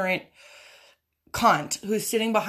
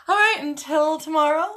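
loud sign-off; 9 semitones higher, 7 dB louder than usual. Real, not acted. Signing off to her viewers.

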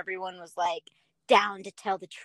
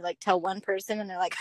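ordinary delivery

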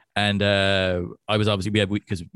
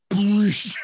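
sudden higher spraying burst; imitated spit take; 6 semitones higher, 3 dB louder than usual. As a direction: blow the word out like spurting liquid. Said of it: "a spit take. It was just like".

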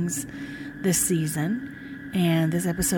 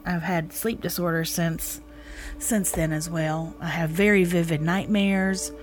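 ordinary talk

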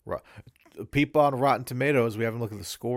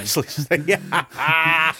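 laughing; 7 semitones higher, 7 dB louder, much slower than usual. Real, not acted. Laughing at the idea.

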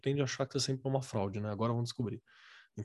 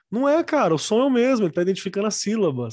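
much higher and louder outburst; heated protest; 7 semitones higher, 8 dB louder than usual. Real, not acted. Defending the child's sounding out, heated loud protest. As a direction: burst out in indignant protest.

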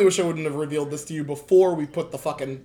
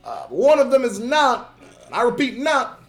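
Obama impression; 7 semitones higher, 4 dB louder than usual. Shown as a demonstration. Obama bit, both speakers.

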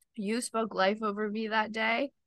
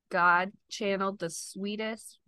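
ordinary talk, nothing marked

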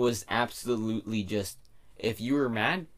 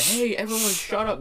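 high-pitched mock scolding; raised-pitch "shut up" to a group; mock telling-off; 10 semitones higher than usual. Imitating annoyed bus telling others off.

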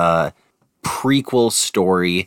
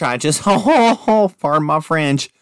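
much higher, slow mock pious voice with a laugh; 8 semitones higher, 4 dB louder than usual. Mocking a prude apologizing for swearing, high and hammed-up. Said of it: "They're like".

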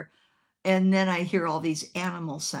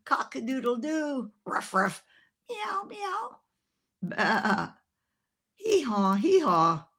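rooster, dog, cat, sheep, donkey in turn; barnyard animal imitations; 7 semitones higher, slower than usual. Preacher imitates lady on the prayer line, barnyard noises. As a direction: mimic each farm animal with gusto. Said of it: "she went".

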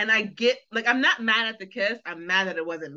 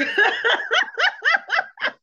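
a loud squealing laugh; high-pitched laughter; 5 dB louder than usual. Real, not acted. Laughing at Brooklyn Amanda.

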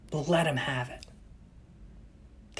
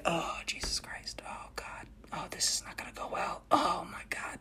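hushed whisper of dismay; soft horrified whisper; whispered dread; 3 semitones higher, 12 dB quieter than usual. Retold or imitated. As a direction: whisper it under your breath. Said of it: "Allie and I are like".

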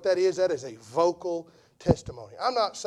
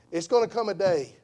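ordinary delivery

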